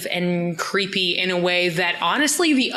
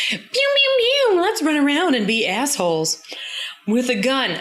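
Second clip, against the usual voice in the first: kid playing with a gun; 6 semitones higher than usual. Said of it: "Fergus is like".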